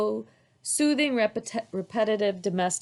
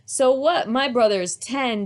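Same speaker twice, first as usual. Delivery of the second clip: put-on boasting child; 4 semitones higher, 6 dB louder than usual. A narrator's reading.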